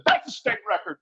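high excited shout of a record; proud dad bursting out at the pool. Retold or imitated. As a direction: jump up and call out the record. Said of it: "jumped up and said".

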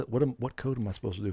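ordinary delivery

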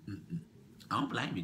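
ordinary talk, nothing marked

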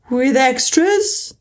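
slow, worried question; a little anxious. Acted. Mock Turtle nervously asks Alice about school, anxious. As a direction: fret over the answer. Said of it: "a little anxiously".

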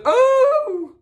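high, loud delighted squeal; squealing Jolene. Retold or imitated. Jolene bursting out for dessert, high loud squeal. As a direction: shriek it like a thrilled hostess. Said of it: "she goes".

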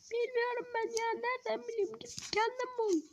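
a brat shrugging off the grown-up; cheeky kid voice. Acted. The kid sassing the player back in a high child voice.

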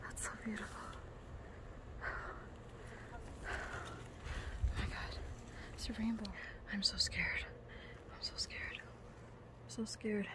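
hushed, breathless awe and fear; real frightened whisper at a height; scared whisper. Real, not acted. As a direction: whisper as if afraid to move. Terrified, whispering on a high ledge.

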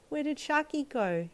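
put-on little girl asking. A narrator's reading. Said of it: "you asked Ijiro's mum".

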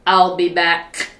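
an old man playfully imitating a film tough guy; Arnold Schwarzenegger. Old man leaving hams up his tough movie catchphrase. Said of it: "his name was Arnold Schwarzenegger".

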